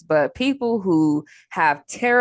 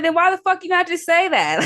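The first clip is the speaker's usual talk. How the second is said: exasperated; 9 semitones higher than usual. Retold or imitated. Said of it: "I'm like".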